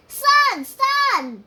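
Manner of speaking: shouting into a well; calling out. A narrator's reading. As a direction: call the sun as if it's far below.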